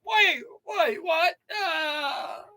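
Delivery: high, crying protest; hammed-up terror at being dragged off; mock panic. Pretending to be hauled to jail, mock panicked cries.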